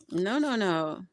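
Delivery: ordinary talk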